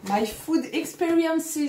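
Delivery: brand name in a put-on English accent. Unboxing vlogger reads the brand name in mock English accent. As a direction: say it like a Brit, drawn out. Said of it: "super accent anglais".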